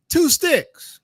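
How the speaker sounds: high, emphatic call; call-and-response cue. Shown as a demonstration. Speaker asks the family to repeat after him, high emphatic call. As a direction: pitch it up sharply for them to repeat.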